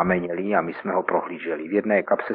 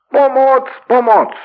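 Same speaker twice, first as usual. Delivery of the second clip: much higher, much louder desperate scream; roaring for help; 9 dB louder, slower than usual. Acted. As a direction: bellow for help in panic. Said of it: "a člověk zařval znovu". (zoufalý řev o pomoc, mnohem výš a hlasitěji)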